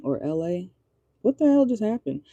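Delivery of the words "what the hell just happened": much higher, real disbelief; amazed. Real, not acted. Reader spooked by her own reading, amazed higher exclaim. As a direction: react like it just spooked you.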